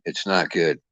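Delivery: ordinary talk, nothing marked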